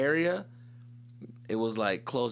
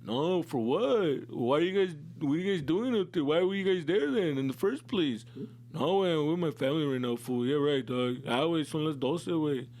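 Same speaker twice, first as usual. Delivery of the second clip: bitchy cousin; 3 semitones higher than usual. Retold or imitated. Older cousin refusing the stranded kids, a whiny mocking voice. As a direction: brush off the kids and refuse to help. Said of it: "one of them was being a bitch".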